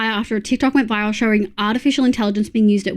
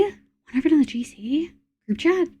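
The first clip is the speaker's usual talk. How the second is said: higher, softer, drawn out, rising at the end; surprised echo question; 5 semitones higher, 5 dB quieter than usual. Real, not acted. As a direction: query it slowly in disbelief. Repeats the news as a question.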